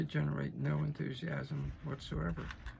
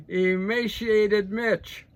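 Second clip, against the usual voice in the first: slow, loud and higher, each syllable of emaciated drawn out in scorn; mocking nickname; 7 semitones higher, 11 dB louder, much slower than usual. Real, not acted. Mocking McConnell to the audience, slow loud sneer. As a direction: stretch emaciated Mitch out like a jeer.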